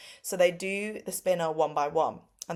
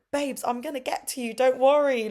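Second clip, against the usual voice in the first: reassuring; 7 semitones higher, 5 dB louder than usual. Retold or imitated. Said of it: "And I was like".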